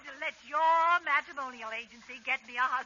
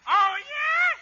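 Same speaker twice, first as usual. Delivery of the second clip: put-on defiant kid shout; 12 semitones higher, 9 dB louder than usual. Sebastian defies Abbott.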